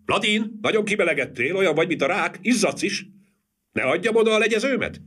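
high, chattering woman's voice; high fussy wife teasing; fussing wife. A narrator's reading. Wife fusses over sweating lawyer.

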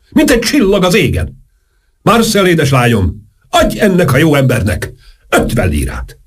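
high, theatrical declamation; grandiose orator. A narrator's reading. Saint-Germain tells Marcel to pay the good man, grandly. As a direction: wave the sum away with pompous grandeur.

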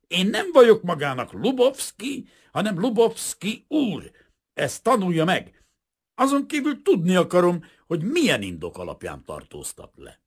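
high, loud, affronted rebuke; indignant protest. A narrator's reading. Insulted Kazimír correcting the arresting detective, indignant. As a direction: demand respect, pitched high and forceful.

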